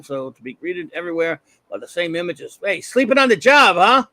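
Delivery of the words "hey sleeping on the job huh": loud, jeering thug; taunting thug. Acted. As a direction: jeer at them like a gangster. Thug taunting gassed workers.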